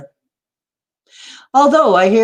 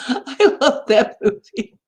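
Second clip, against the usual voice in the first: slow, higher words through laughter; laughing; 6 semitones higher than usual. Real, not acted. Fan remembering a favorite movie quote, laughing as they speak. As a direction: laugh right through the line. Amused after quoting a comedy film.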